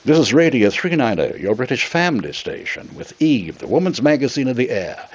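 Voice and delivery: British announcer accent on the station ident; English accent. Shown as a demonstration. Auditioning for the station, a polished English accent. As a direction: put on your best English broadcaster accent. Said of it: "my acting abilities came to my mind".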